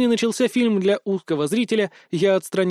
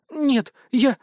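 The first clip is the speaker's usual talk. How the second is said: higher, flustered denial trailing off; embarrassed; 7 semitones higher than usual. A narrator's reading. Potion-maker caught out by commandant, embarrassed. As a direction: start to deny, then falter. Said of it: "смутилась любительница зелий".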